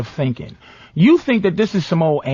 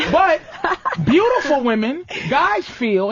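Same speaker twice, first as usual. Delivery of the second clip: ordinary talk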